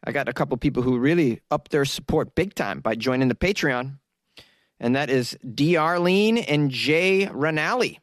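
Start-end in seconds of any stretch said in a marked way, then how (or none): none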